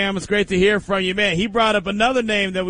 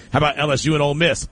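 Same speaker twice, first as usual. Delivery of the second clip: rushed; faster than usual. Real, not acted. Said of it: "Hold up, hold up".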